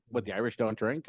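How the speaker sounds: ordinary talk, nothing marked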